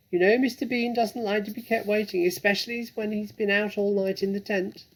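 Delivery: high, loud bossy farmer's wife; bossy Mrs Bean. Acted. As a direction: call down like an impatient mistress.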